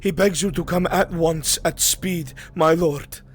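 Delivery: breathless urgent messenger. Acted. Runner begging the lord to come at once, winded. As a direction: plead urgently through short breath.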